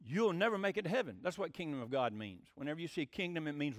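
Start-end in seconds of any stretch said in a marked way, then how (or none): none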